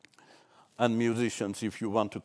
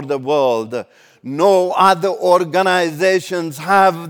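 full-force preaching; 6 semitones higher, 10 dB louder than usual. Acted. Pastor proclaiming the church's culture to the congregation, loud preaching.

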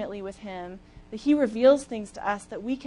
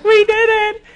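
elated; 11 semitones higher, 11 dB louder than usual. Retold or imitated. Crossing finish line, hugging runners.

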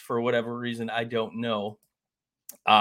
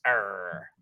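pirate voice; 6 semitones lower, 6 dB quieter than usual. Acted. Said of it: "I don't have any pirate voice".

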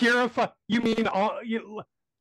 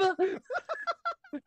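high squealing laugh; 6 dB quieter than usual. Real, not acted. Laughing at own joke.

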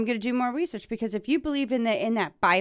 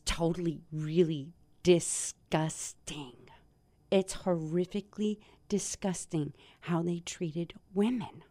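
disgusted whisper; 6 semitones lower, 8 dB quieter than usual. Real, not acted. Vlogger appalled by what he read, a disgusted whisper. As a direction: breathe it out in disgust.

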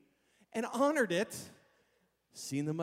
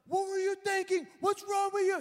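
high, loud outraged yelling; mock irate screech; tearing into someone; 11 semitones higher, 6 dB louder than usual. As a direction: imitate a furious driver chewing someone out. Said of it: "He starts tearing into me".

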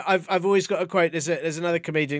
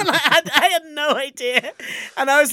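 flustered laugh; 9 semitones higher, 5 dB louder than usual. Real, not acted. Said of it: "I just went pale".

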